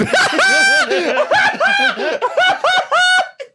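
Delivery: explosive high shrieking laugh; a laugh that blows out the speakers; loud laugh. Real, not acted. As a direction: blast out a huge cackle. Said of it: "I just blew people's speakers out with that".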